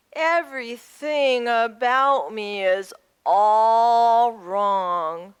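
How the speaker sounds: slow, drawn-out lament; dejected sheep. Acted. Little sheep doubting himself to the other animals, dejected. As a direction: stretch the words into a woeful complaint.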